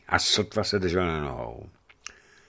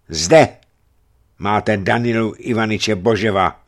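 loud booming announcement; thundering; 2 semitones higher, 8 dB louder than usual. A narrator's reading. Varlám hands the boyar to the mob, thundering. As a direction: boom it out over the crowd. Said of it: "zahřměl Varlám".

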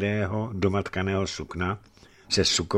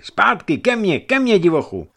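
shouting at dogs; 11 semitones higher, 9 dB louder than usual. A narrator's reading. Young man shouting his dogs back, loud and high. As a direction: yell the dogs back across the clearing. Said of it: "křičel na psy".

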